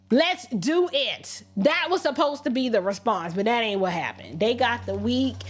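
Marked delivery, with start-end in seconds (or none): 0.1-1.4 slow, drawn-out let's do it; slow defiant dare. Retold or imitated. Telling viewers to answer a threat with a slow deliberate dare. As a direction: spell out the challenge slowly and firmly. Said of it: "You was supposed to be like".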